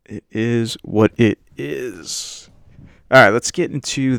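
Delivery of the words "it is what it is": very slow, stretched-out words; drawn out. Real, not acted. Host resigned to his bad impressions, drawing it out. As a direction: drag out each word of the catchphrase.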